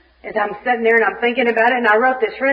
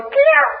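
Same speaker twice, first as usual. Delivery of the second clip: high yowling cat meow, much higher. Retold or imitated. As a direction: let out a shrieking cat yelp. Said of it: "the cat goes".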